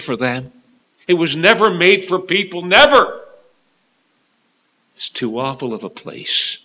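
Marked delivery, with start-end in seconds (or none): 1.1-3.2 raised voice leaping on the last "never"; emphatic protest. Real, not acted. Preacher on hell not meant for people, emphatic. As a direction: insist loudly, hammer the final word.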